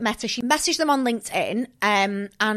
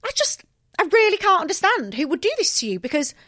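higher, lightly mocking Ashley; a hint of a whiny friend; imitating Ashley; 6 semitones higher, 4 dB louder than usual. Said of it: "Ashley was like".

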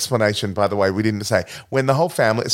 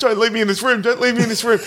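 high-pitched, repeated desperate plea; mock panicked pleading; 11 semitones higher, faster than usual. Trapped cop begs his colleagues, mock panic. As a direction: imitate a trapped man begging.

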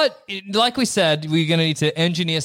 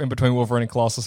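ordinary delivery

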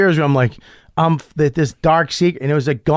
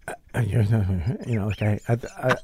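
low, soft, halting false starts; flustered stammer; 4 semitones lower, 7 dB quieter than usual. Retold or imitated. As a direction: act out being lost for words. Stammering after being challenged on confession.

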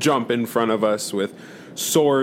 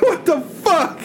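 shocked yelp. Real, not acted. Shock at crewmate blown up.

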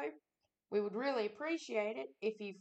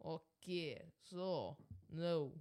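put-on slow, deliberate Russian-accented sign-off; 3 semitones lower, 4 dB quieter than usual. Co-host reluctantly saying goodbye to listeners, Russian accent. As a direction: plod through it in a Russian accent. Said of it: "Give me your Russian accent".

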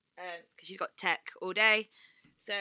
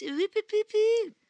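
panto voice; 14 semitones higher, 6 dB louder than usual. Pretend. Panto script bit.

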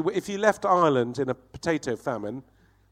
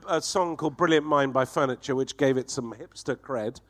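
usual speaking voice

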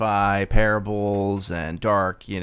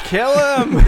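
much higher, louder joking yell; mock shout; 6 dB louder than usual. Hosts jokingly heckling the AI writer, with a loud high yell. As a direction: heckle him playfully. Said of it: "mock heckling the AI".

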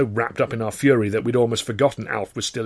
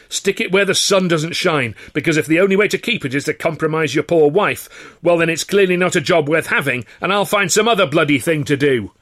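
furious tirade; 8 semitones higher, 6 dB louder than usual. Acted. Actor quitting in a rage at his boss, furious. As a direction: let the whole rant rip.

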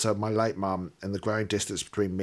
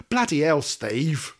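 mock exclamation; 5 semitones higher, 4 dB louder, slower than usual. Real, not acted. Interjects while reading letter.

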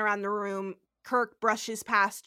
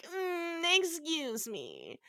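soft, slow, prissy nerd correction; nerdy correction; 6 semitones higher, 6 dB quieter, much slower than usual. Retold or imitated. Mocking a Star Trek nerd correcting IMDB, soft slow prissy. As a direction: play a fussy fan butting in. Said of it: "I'm gonna be that, like, Star Trek".

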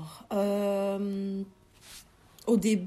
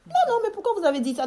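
much higher, louder and fast, a mock startled employer; startled reply; 12 semitones higher, 5 dB louder, faster than usual. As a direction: blurt it out as if caught off guard. (employeur surpris, aigu et rapide)

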